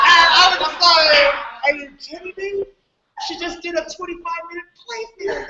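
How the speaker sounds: high voice, laughing through the words. Real, not acted. Telling the hangout how a show ran long, laughing. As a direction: talk while still cracking up.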